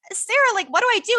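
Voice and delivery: high-pitched mock panic; helpless plea. Teen handed vodka asking her counselor, mock helpless plea. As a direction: plead for instructions in a high voice.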